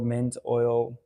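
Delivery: normal talking voice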